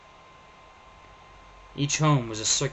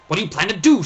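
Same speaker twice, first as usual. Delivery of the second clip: sharp; 9 semitones higher, 12 dB louder, faster than usual. Acted. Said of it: "said Thomas sharply".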